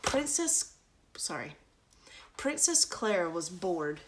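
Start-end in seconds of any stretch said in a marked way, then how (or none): none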